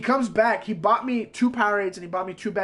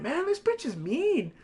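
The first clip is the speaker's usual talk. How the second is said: slow, higher, quieter grumble; sulky muttering; 8 semitones higher, 4 dB quieter, slower than usual. Retold or imitated. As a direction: sulk it under your breath. Sulking in hallway.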